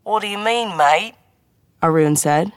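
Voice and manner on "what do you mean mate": mock British accent sliding into Australian; bad British accent. A narrator's reading. Colleague joking with agent, botched British accent sounding Australian. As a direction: put on a hammy Australian-tinged British accent, pitched up. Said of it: "when I do a British accent, I don't sound Australian".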